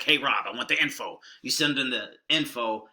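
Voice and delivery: usual speaking voice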